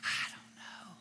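doubtful whisper. Retold or imitated. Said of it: "you're going".

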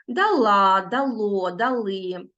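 дала, дало, дали stressed on final syllable; stress on endings. Shown as a demonstration. Teacher shows pupils ending stress, stressing last syllable of дала, дало, дали. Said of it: "наголос падає на закінчення".

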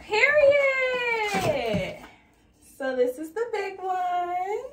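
high, loud, drawn-out exclamation; playful hype squeal. Acted. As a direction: squeal it high and hold it long. Showing off new vases.

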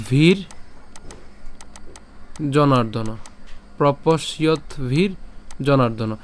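প্রপস্যত ভীর জনার্দন split slowly; broken into syllables. Shown as a demonstration. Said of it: "কিভাবে ভাঙলাম". (প্রপস্যত ভীর জনার্দন ভেঙে ভেঙে ধীরে)

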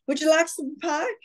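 high-pitched perfect little sixties mom. Acted. Actress offering people pie in the blooper reel, sixties mom voice. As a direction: play the hostess mom, pitched well up. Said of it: "the perfect little sixties mom".

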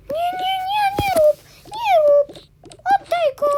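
very high squeaky play voice; high, squeaky toy-character pleading; squealed protest. Pretend. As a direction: squeal a pretend panicked protest. Acted squabble over the basket.

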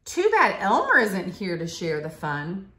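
plain reading voice

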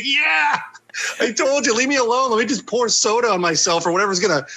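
high, laughing words; can barely get it out for laughing; laughing through it. Real, not acted. Telling mom to leave him alone while laughing high. Retelling own reply, laughing hard.

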